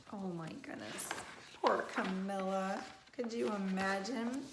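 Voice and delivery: soft, low gasp of dismay; quiet narrator aside; hushed aside. Real, not acted.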